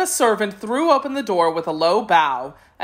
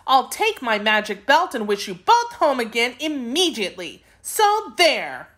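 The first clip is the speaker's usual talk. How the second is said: put-on high-pitched girl laying down the law; stern; 6 semitones higher than usual. A narrator's reading. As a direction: scold them firmly in a high voice. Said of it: "said Dorothy sternly".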